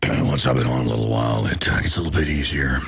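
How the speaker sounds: very low, worn-out croak; hoarse, strained voice. Real, not acted. Host explaining his voice after ranting, very low and hoarse. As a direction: struggle to talk on a voice worn from ranting. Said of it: "it's really hard to talk".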